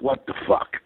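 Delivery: breathy whispered "what the"; whispered disbelief. Retold or imitated. Actor learning he lost the role, whispering in disbelief. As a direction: mutter it under your breath in disbelief. Private reaction after not booking.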